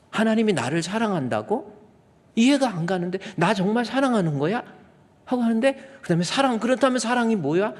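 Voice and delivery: amazed questioning. Retold or imitated. Wife stunned by the sermon, amazed questioning. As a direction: lift it in stunned wonder.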